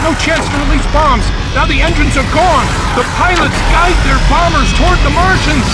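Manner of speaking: building from tense counting to high, loud panic; rising alarm to frantic shout. Acted. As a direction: voice climbing as the bombers dive and burn.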